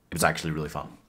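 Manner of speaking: low and understated; quiet admission. Real, not acted. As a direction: admit it quietly, dropping the voice. Quiet admission, then restated normally.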